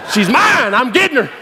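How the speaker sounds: high, hammed-up battle cry; fired up. Cowboy about to catch this cow, fired up. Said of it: "I'm getting so fired up".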